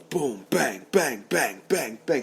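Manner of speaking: punchy falling hits, one per word; evenly hammered sound-effect words; imitated blows. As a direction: rattle off each blow like a comic-book punch.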